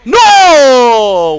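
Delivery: high, loud shriek of dismay; dismayed scream. Real, not acted. Gamer caught by enemy, loud shriek. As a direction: yell as you get caught. Killed again.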